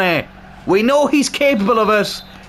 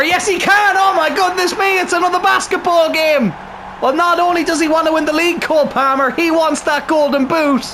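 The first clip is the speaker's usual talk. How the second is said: excited shout; 9 semitones higher than usual. Real, not acted. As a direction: cheer it out. His team scores again.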